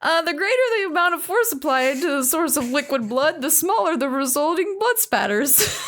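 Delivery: high, solemn movie-trailer line; dramatic determined voice. Acted. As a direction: deliver it like a defiant heroine. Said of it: "when you did that voice".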